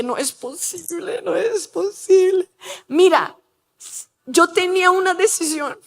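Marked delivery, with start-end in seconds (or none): 0.0-1.2 hushed disbelief. Retold or imitated. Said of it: "Yo dije". 1.2-2.5 tearful, breaking disbelief; near tears. Retold or imitated. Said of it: "Yo dije".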